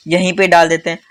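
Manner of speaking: normal talking voice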